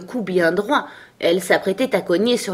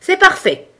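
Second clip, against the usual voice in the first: very high, loud royal yell; high-pitched bawled approval; bawling; 13 semitones higher, 6 dB louder than usual. A narrator's reading. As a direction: bawl it out imperiously. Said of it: "brailla la reine".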